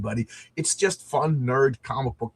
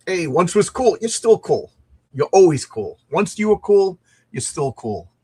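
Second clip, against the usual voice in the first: louder, higher hype shout-out; excited hype; 6 semitones higher, 7 dB louder than usual. Real, not acted. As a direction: pump up a viewer with cheer. Hyping a viewer loudly.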